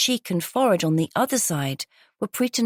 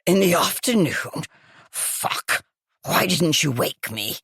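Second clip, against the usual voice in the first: croaky woman. Acted.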